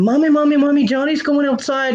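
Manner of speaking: put-on high, urgent little girl calling mommy; child calling. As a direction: call out like an excited little girl. Daughter calling out, voiced.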